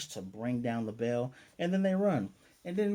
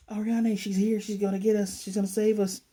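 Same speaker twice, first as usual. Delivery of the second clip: excited rescue cheer; 8 semitones higher, 6 dB louder than usual. Retold or imitated. Elf women cheering rescue.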